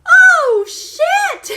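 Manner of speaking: high, loud startled oh shit; shocked outburst, high and loud; shocked yell. Retold or imitated. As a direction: blurt it out in shock. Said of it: "I went".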